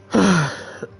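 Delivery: big audible exhale; sigh. Real, not acted. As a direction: let out a heavy sigh. Sighs before resuming.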